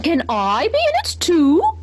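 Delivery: high, slow pleading question; a cow who wants in; hopeful cow. A narrator's reading. Cow asks Henry to put her on the map, high.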